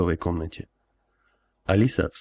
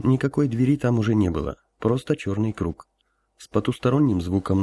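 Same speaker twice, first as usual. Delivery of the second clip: plain reading voice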